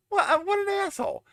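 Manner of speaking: very high incredulous insult; outraged squeal. Real, not acted. Exaggerated repeat.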